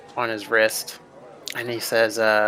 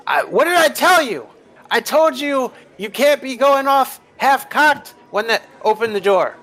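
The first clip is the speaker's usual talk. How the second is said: high, loud screaming rant; furious shout; 13 semitones higher, 4 dB louder than usual. Acted. Dyer yelling at the guys for going off half-cocked, shouting. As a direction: explode at them, voice cracking high. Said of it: "Dyer just starts, and he's like".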